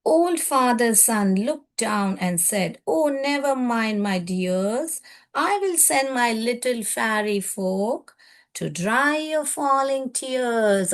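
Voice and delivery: put-on slow, drawn-out reading of a children's poem; teacher reading verse to class. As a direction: read each line slowly so children follow. Teacher reads poem aloud slowly.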